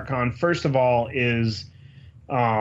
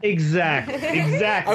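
laughing; 6 semitones higher than usual. Real, not acted. Laughs at line joke.

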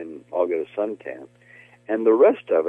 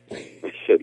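ordinary talk

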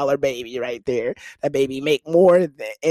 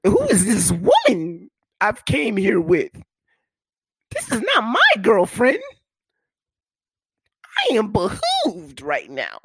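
put-on shocked boyfriend; 7 semitones higher, 4 dB louder than usual. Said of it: "And I was like".

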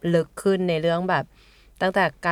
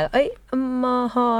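higher, hesitant try at an unfamiliar name; slow, careful attempt; 6 semitones higher than usual. Organiser imitating everyone saying the festival name, slow and careful. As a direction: sound out the name slowly and cautiously. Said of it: "จะเหมือนแบบพูดช้าๆ นิดนึง".

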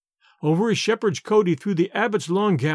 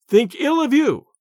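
raised, louder indignant question; indignant echo; 7 semitones higher, 4 dB louder than usual. A narrator's reading. King scoffing at the abbot's plea, indignant and louder. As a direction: throw his words back at him, outraged.

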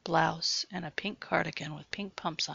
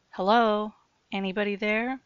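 raised voice, uneasy call; 3 semitones higher, 5 dB louder than usual. Acted. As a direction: call into the dark room. Calling into a dark basement.

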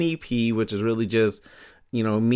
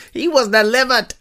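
high silly voice; 13 semitones higher, 6 dB louder than usual. Pretend. Playful high put-on voice.

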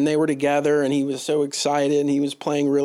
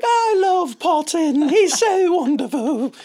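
high, louder, slow Scottish gushing; Scottish accent; 6 dB louder, slower than usual. Retold or imitated. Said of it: "That's my Scottish accent".

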